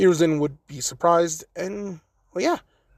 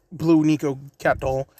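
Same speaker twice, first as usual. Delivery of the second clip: plain reading voice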